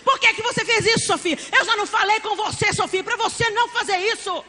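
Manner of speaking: high-pitched, hammed-up telling-off; exasperated, agitated nagging pitched high; worked-up scolding mother. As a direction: scold a child in a mock frenzy.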